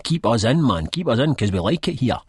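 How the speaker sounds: a sarcastic parody of a party begging to stay in power; mocking whine. A narrator's reading. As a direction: sneer it like a clingy politician. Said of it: "not a nice way of saying".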